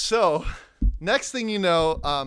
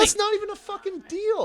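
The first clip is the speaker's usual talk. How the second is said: very high mock-outraged whine; hammed-up haggler's complaint; indignant protest; 4 dB louder than usual. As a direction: object in exaggerated disbelief, pitched way up.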